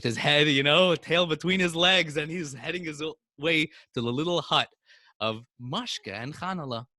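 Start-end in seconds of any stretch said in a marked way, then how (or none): none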